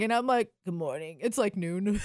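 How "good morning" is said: groggy. Retold or imitated. Hungover reply to husband.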